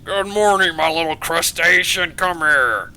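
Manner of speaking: big welcoming holler to a daughter; hearty fatherly greeting. Acted. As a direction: boom out a hello to your little one.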